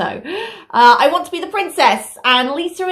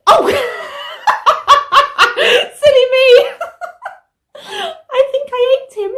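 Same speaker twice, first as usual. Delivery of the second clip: giggly mock surprise; 12 semitones higher, 4 dB louder than usual. Pretends she ate Bottom.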